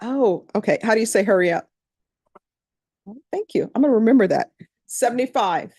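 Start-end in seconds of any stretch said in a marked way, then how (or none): none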